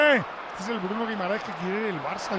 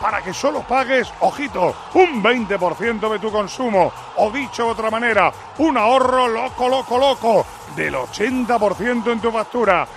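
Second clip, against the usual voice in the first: upbeat radio ad voice; ad read; 2 semitones higher, 6 dB louder, slower than usual. Acted. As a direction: sell it like a live radio spot. Live ad read on air. (lectura de anuncio radiofónico)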